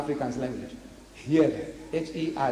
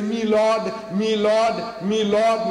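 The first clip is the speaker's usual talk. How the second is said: "me lord" in a louder, higher English address to a judge; English courtroom accent; 5 semitones higher, 4 dB louder, much slower than usual. Retold or imitated. Englishman addressing the magistrate, mock English "me lord". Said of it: "The Englishman is talking about the magistrate".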